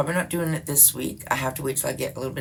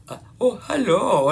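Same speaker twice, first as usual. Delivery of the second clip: higher, louder put-on hello hiding a South African accent; disguised South African accent; 7 semitones higher, 4 dB louder than usual. Mimicking the scammer posing as someone else, disguised South African accent.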